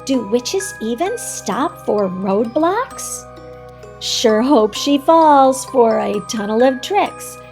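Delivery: usual speaking voice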